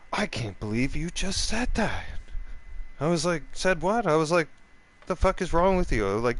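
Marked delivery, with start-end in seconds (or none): 0.1-2.0 higher-pitched mock offended voice, climbing then falling; dramatic shock. Offended man answering the drinker, mock dramatic shock. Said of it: "like dramatic like that". 3.5-4.0 high, incredulous retold question; indignant retort. Drinker answering the offended man, indignant retort. 5.0-6.0 high, louder retold challenge; indignant confrontation. Drinker confronting the offended man, indignant outburst.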